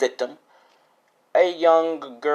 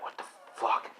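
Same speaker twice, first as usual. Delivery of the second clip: hushed curse; 10 dB quieter than usual. Acted. Chameleon thrown against the wall.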